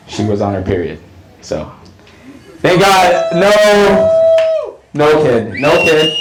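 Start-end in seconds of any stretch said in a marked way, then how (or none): 2.6-6.2 loud, high relieved cry; loud relief at the punchline; relieved shout. Real, not acted. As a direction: burst out thank God with relief.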